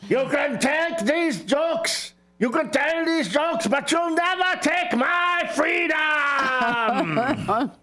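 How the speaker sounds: high, slow Scottish battle cry; hammed-up Scottish shout, drawn out to the end; William Wallace. Host mocking the bad jokes as a rallying Scottish warrior. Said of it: "I heard William Wallace groan".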